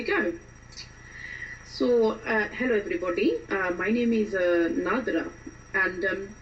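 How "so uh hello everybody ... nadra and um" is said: Indian-accented self-introduction. Shown as a demonstration. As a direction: greet everyone in an Indian accent. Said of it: "going to do an Indian accent".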